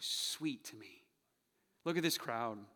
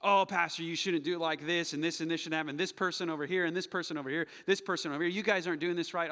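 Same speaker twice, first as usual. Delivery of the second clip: raised, louder fault-finding voice; attacking complaint; 3 semitones higher, 7 dB louder than usual. Acted. Church member attacks the pastor's process, loud complaining. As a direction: pile up objections insistently. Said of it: "is to attack the process".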